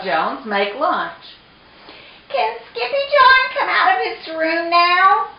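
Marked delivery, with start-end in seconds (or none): 2.3-5.3 very high, pleading little question; little kitten sister. A narrator's reading. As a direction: ask in a small high kitten voice. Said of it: "asked Jujubee".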